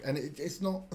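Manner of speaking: ordinary talk, nothing marked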